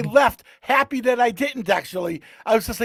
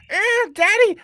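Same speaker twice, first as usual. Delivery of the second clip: much higher mock moaning daddy; 12 semitones higher, slower than usual. Retelling the hookup, he mimics her moaning daddy. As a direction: imitate her moan, pitched way up. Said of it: "she starts going".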